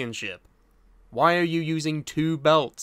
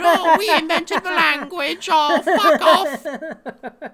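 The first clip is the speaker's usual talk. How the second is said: high, loud mock posh English accent; hammed-up posh English voice; 12 semitones higher, 9 dB louder, slower than usual. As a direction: lampoon a stuffy English gent sipping tea. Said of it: "I used a different word".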